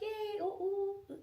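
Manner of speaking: high, drawn-out little cheer; mock happy. Woman briefly happy before sadness returns, high mock cheer. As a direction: squeal a small stretched-out yay.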